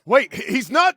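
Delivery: stammered, high, loud protest; sudden alarm. Acted. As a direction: break off in startled alarm. Sudden alarm, interrupts himself.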